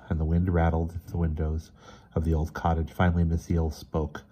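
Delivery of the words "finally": ordinary delivery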